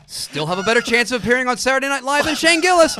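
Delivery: loud and high, like a game show host; mock announcer shout. Taunting a comedian with a loud announcer voice.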